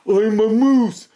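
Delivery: high, slow cry; cried out. Acted. Morris crying out to the deer that he is a moose. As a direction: cry out in protest. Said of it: "cried Morris".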